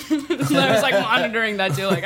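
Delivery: normal talking voice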